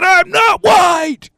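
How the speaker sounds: high, loud, slow shout; looking-to-kill anger. Acted. Man fed up with the insult, furious shout. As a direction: roar it out with every word stretched. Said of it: "I'm looking like I'm gonna kill".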